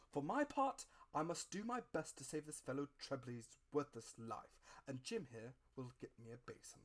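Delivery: a no-nonsense country doctor giving orders. A narrator's reading. Doctor taking charge over the stricken captain, brisk doctor.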